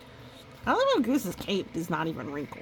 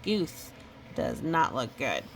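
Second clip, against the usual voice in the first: ordinary delivery, nothing marked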